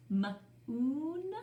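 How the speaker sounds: moon split into m and oon, stretched; drawn out m-oon. Shown as a demonstration. Teacher sounding out to class, stretched. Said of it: "let's try it both ways".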